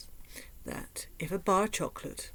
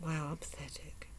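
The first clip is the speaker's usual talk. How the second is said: self-mocking whisper; 4 semitones lower, 10 dB quieter than usual. Real, not acted. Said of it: "self-mocking aside".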